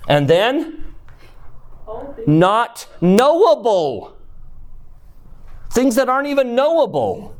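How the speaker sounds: escalating proclamation; building preacher's climax. Acted.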